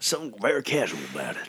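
teasing Southern impersonation; mock Southern accent. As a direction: mimic a friend's Southern twang. Mimics co-host's accidental Southern drawl.

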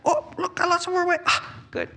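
very high, pleased little voice; high-pitched pleased discovery; delighted surprise. Acted. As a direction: squeak with acted delight, then sigh in relief. Dieter delighted on scale again.

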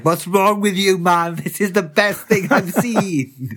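high, loud, laughing protest; outraged delight, laughing through it; indignant excitement. Retold or imitated. Defending his favourite book to a colleague, excited and laughing.

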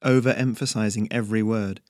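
usual speaking voice

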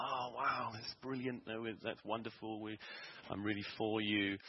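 lightly voiced happy mother; delighted mum. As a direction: gush like a thrilled mum. Mum's delighted reaction retold.

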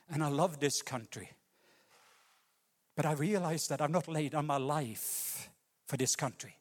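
ordinary talk, nothing marked

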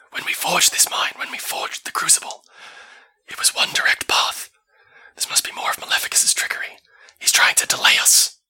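hushed whisper voice; breathy urgent whisper; whispering Elva. Acted.

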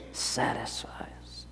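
whispered, slow and soft; hushed whisper. Acted. Preacher whispering the promise to his congregation, hushed. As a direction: let the word fall to a hush.

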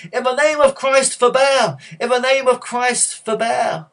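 loud, high, impassioned monk's shout; full-voiced cry to halt the fight. A narrator's reading. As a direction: shout it over fighting gladiators.

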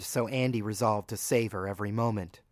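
ordinary delivery

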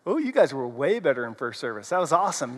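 ordinary delivery

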